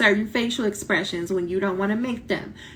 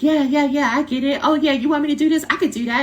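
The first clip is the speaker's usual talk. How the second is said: eager student; 7 semitones higher, 5 dB louder, faster than usual. Retold or imitated. Eager student voiced to chair.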